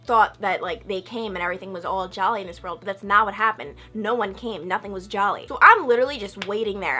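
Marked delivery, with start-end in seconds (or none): none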